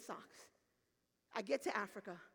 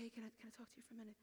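very soft, rapid and hesitant; hushed aside; 13 dB quieter, much faster than usual. Retold or imitated. Pastor asks woman in charge aside, hushed.

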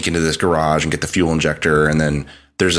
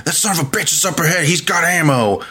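mock Oregon biker; 11 semitones higher than usual. Podcaster mocking Boozer's dialogue in a hammed-up biker accent. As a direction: rattle off the cheesy line in a put-on twang. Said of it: "Boozer's like".

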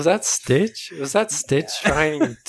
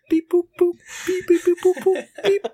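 toy robot voice; 13 semitones higher than usual. Pretend. Acting out a voicemail beep.

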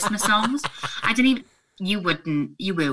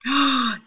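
sharp, raised gasp; delighted gasp; 7 semitones higher than usual. Real, not acted. Co-host hearing the tree went up in November, delighted gasp. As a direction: gasp with mock-shocked delight.

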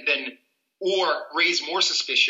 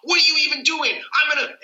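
high-pitched, indignant challenge, acted out; confrontational outburst; 12 semitones higher than usual. Acting a driver who reaches under the seat, confrontational. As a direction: play a driver mouthing off at the police. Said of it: "You don't wanna be like".

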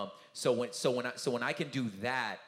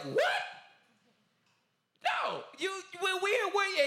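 flustered disbelief; 5 dB louder than usual. Retold or imitated. Flustered reply in retold debate.

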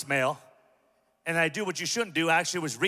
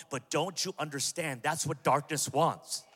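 ordinary talk, nothing marked

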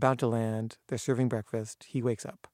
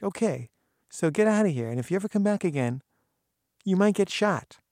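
higher, louder threat; threatening order; 7 semitones higher, 6 dB louder than usual. Retold or imitated. Gardener ordering trespassers off, loud threat. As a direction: run them off for good.

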